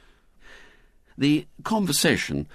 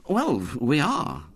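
slow, higher, matter-of-fact admission; simple admission; 7 semitones higher, slower than usual. A narrator's reading. Henry admitting they are scared, said simply. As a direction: admit it simply, without fuss. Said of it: "said Henry simply".

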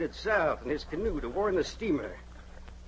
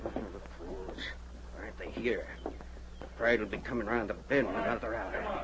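low muttered grumble under the breath; muttering to himself; 8 semitones lower, 6 dB quieter than usual. Acted. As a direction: mutter it quietly to yourself. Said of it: "The old man muttered to himself".

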